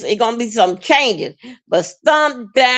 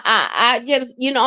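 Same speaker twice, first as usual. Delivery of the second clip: ordinary talk, nothing marked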